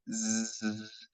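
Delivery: زز held as a long voiced buzz; long Z sound. Shown as a demonstration. Teacher testing vibration in the throat, long buzzed زز. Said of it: "لكن لو صوت ال Z".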